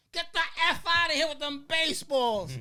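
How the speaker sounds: a loud, high, furious blast; a star snapping at a kid bat boy; angry shout. Retold or imitated. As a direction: bark it out like an irritated ballplayer. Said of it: "and he goes".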